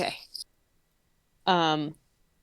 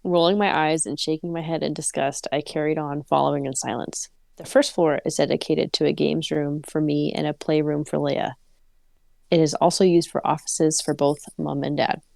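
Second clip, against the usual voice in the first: story narration; 4 semitones lower than usual. Acted. Reads the story aloud.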